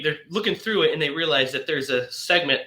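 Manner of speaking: ordinary delivery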